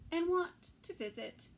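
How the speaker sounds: ordinary delivery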